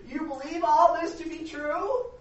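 high, loud, slow taunt; hammed-up incredulous mockery, stretching each phrase; mocking lawyer. Agnostic lawyer to little girl, loudly mocking. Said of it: "he's mocking her".

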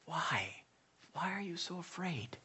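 soft, hushed, gentle question; tender, heartbroken. Retold or imitated. Jesus gently asking his frightened disciples, soft and tender. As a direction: ask it with a breaking heart. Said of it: "I think it was said".

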